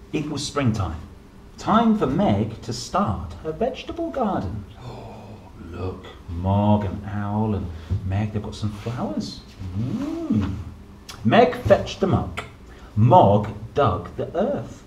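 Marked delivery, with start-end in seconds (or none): none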